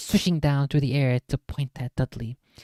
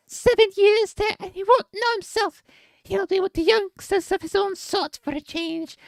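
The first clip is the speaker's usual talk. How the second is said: high mock giant voice, much higher; a put-on Hagrid growl pitched way up; 3 dB louder than usual. A narrator's reading.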